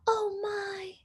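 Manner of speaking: breathy whispered fret; worried whisper. A narrator's reading. Baby frets softly at the chase, whispering.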